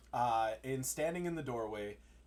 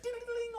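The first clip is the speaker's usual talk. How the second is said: high, rapid dinging; imitated bell jingle; 4 dB quieter than usual. Game master imitating tavern door bell, high jingle. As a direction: jingle like a little doorbell.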